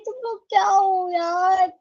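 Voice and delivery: ordinary delivery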